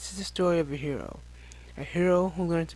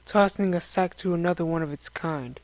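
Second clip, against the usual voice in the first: plain reading voice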